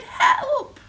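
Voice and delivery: very high, loud cry for rescue; hammed-up high yelp; panicked cry. A narrator's reading. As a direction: shriek it in storybook panic. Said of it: "the other one cried".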